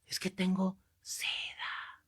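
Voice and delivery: slow, hushed whisper; acted confiding whisper. Man confessing to the priest in private, acted whisper. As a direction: whisper it as a shameful secret.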